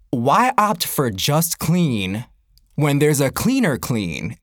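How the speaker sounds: slow, low, deliberate pitch; measured explanation. Retold or imitated. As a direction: spell it out slowly, as if making a reasonable case. Reenacts patient explanation to people.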